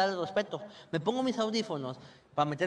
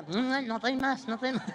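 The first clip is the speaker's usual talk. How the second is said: slow, higher put-on murmur; mock hungry girlfriend; 3 semitones higher than usual. Comedian voicing his girlfriend's leftover hunger, slow murmur. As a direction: play her still hungry, slow.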